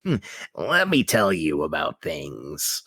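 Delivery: low, slow hammy villain voice; Raiden. Retold or imitated. Podcaster mocking an actor's performance, deep slow villain voice. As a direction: intone it slowly and deep, mock villain. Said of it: "he's doing a villain voice".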